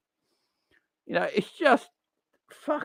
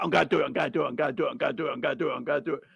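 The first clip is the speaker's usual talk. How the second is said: softer, lower mocking chant of promises; mock empty promises; 6 semitones lower, 6 dB quieter, much faster than usual. Mocking online fans who never act, in a soft sneer. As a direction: repeat it like an idle boast. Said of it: "Don't just keep tagging everyone online about".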